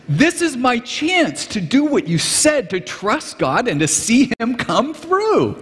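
high, slow, eager quote with a laugh in it; excited friend. Retold or imitated. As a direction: savour every word like a man sure of a miracle. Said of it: "He was so excited".

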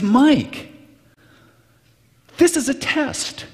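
high, eager quote of the friend, voice climbing on the test line; excited friend. Retold or imitated. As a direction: play the thrilled friend who sees his big chance. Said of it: "He was so excited".

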